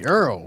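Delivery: "Earl" said with an r-coloured vowel, like the name; the New Orleans way to say "Earl"; southern "Earl" for oil. Shown as a demonstration. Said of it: "we say Earl".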